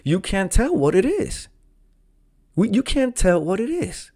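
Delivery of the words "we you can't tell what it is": ordinary talk, nothing marked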